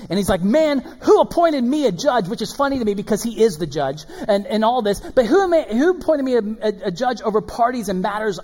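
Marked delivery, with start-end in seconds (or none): 0.4-2.3 high, louder exasperated question; acted exasperation, pitched up. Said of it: "Jesus is exasperated by this". 5.0-8.4 high, loud protesting question; acted incredulous exasperation; exasperated. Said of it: "Jesus is exasperated by this".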